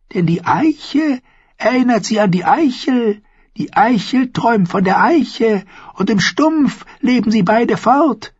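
put-on high, eerie old woman's voice; prophesy like a strange old crone; high crone telling her dreams; old dwarf seeress. A narrator's reading.

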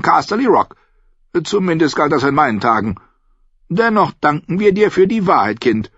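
put-on high, forceful old-woman voice; imperious grandmother, pitched high; assured old lady. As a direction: declare it like a proud matriarch. Old lady answers the girl.

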